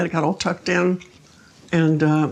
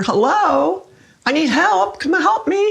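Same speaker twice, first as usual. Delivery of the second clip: retold cry for help, pitched much higher; a higher, more urgent call that is not truly screamed; louder mock scream; 8 semitones higher, slower than usual. Retelling her night calls for the helper, now higher and more urgent. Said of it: "So I started screaming louder".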